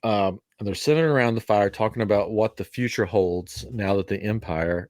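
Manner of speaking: ordinary talk, nothing marked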